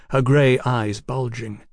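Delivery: ordinary delivery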